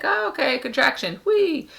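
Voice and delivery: high, light and carefree, ending on a squeaky whee; sing-song mock nonchalance; high breezy mock. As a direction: act unbothered, like riding a slide. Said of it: "I was just kinda like".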